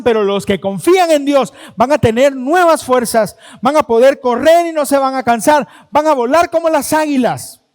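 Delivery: loud, fervent preaching cry to the congregation; full-force preaching. Acted. Preacher rallies the congregation with loud, fervent preaching. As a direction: preach it out with fire, voice ringing.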